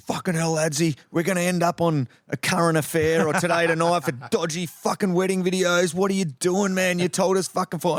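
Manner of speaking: high, exasperated mock rant at a mate. Mono berating his mate over the wrong time, exasperated and high.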